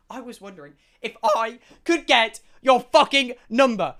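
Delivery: loud, building mock request; exultant retelling. Third girl asking for his number, retold as exultant climax. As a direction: swell into a triumphant climax.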